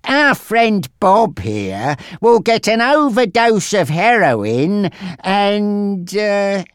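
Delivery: high, slow, drawn-out taunt; mocking gangster Frankie. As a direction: stretch out the threat with relish.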